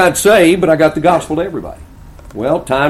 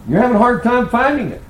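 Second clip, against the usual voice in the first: high, knowing tease; teasing; 11 semitones higher, 3 dB louder than usual. Retold or imitated. Preacher needling the uncle about purgatory, high mock-innocent tease.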